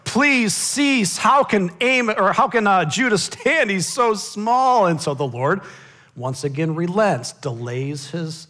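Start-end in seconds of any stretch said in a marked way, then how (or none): 0.0-4.9 high, loud plea, slowing at the end; pleading prophet. Acted. Amos interceding again for tiny Judah. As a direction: cry out to God for mercy. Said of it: "Amos intercedes".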